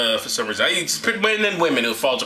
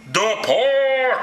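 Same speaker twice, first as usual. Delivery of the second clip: high, drawn-out booming command; God voice; 8 semitones higher than usual. Acted. God commanding Paul to leave, grandly proclaiming. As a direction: proclaim it like a mock deity. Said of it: "this is my God voice".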